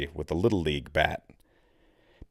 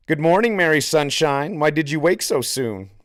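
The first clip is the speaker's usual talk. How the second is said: teasing singsong, high and loud; mocking singsong; 7 semitones higher, 5 dB louder than usual. A narrator's reading. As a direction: sing it out to mock him. Victor mocking Santi with a rhyme.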